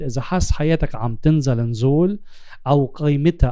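usual speaking voice

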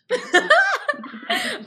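big high burst of laughter; loud high laugh. Real, not acted. Host laughs hard at co-host's teasing, loud high laugh.

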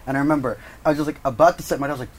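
ordinary talk, nothing marked

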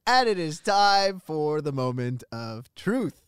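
dramatic host build-up, slowing at the end; announcer. Acted. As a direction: announce it like a game-show reveal. Host announces verdict of debate.

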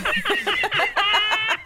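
big burst of real laughter, high and loud; a loud, high-pitched real laugh. Real, not acted. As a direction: crack up loudly at the joke. Host cracks up at the joke.

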